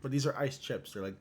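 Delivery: talking while crunching ice; chewing ice. Real, not acted. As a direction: mouth full of ice chips. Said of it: "Excuse me for chewing ice".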